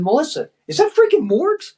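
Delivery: high, excited disbelieving question; amazed question. Retold or imitated. Calling to Melissa about a reel on Instagram, amazed.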